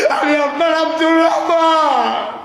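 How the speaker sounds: high, loud wailing cry; tearful shout. Acted. Companion revealing himself to the Prophet, loud tearful wail. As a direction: cry out who you are at full voice.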